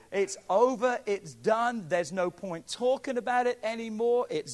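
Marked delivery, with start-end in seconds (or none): none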